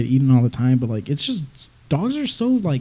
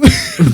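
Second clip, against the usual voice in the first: real high-pitched laugh; high giggle; 12 semitones higher, 5 dB louder than usual. Real, not acted. Laughing about fart sounds.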